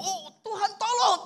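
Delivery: high, slow cry for help. Retold or imitated. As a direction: wail up to God. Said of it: "Dia teriak lagi". (teriakan minta tolong yang tinggi dan pelan)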